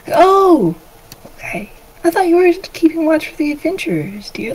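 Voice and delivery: high motherly voice; indulgent mother. Acted. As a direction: gently question your child in a high voice. Mother replies to her son.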